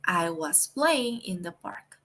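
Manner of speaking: each word slow and clear for learners; slow model sentence. Shown as a demonstration. Teacher to Spanish-speaking students, slow clear model sentence. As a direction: read it out slowly so the class can follow. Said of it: "y el complemento que es in the park".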